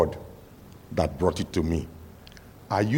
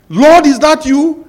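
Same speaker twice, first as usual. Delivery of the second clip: hopeful shout; 14 dB louder than usual. Acted. Man hanging calls to God.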